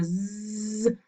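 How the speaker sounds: "z" held as a long buzz with the voice on; voiced buzz. Shown as a demonstration. Teacher contrasts with the hiss, long buzzed "z". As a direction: draw out a vibrating "z". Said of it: "Hay vibración en las cuerdas vocales".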